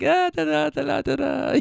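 high-pitched imitation of baby babble; mock baby chatter; babbling baby. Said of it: "he's just sitting there like".

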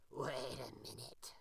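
low, slow grunting gator; grunting alligator. A narrator's reading. The alligator stops the rabbit, low and grunting. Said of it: "grunted the alligator".

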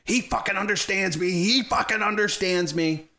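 high-pitched mock outburst; Barnes frustrated. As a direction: imitate an exasperated soldier insisting, repeating it hard. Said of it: "Barnes is getting frustrated".

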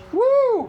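loud, high "woo"; celebratory whoop. Real, not acted. Preacher celebrating the congregation's answer, whooping. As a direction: let out a soaring cheer.

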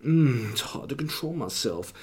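[name] impression. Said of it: "as if I am [name]".

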